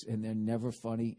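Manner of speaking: ordinary talk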